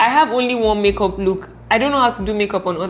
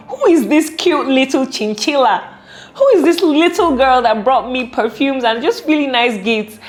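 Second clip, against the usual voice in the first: higher-pitched mock amazement over someone adorable; delighted shock; 5 semitones higher than usual. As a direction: act out being pleasantly astonished. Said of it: "I was so shocked".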